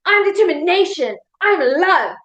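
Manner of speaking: punchy motivational affirmations; power statement. Shown as a demonstration. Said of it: "you're gonna say".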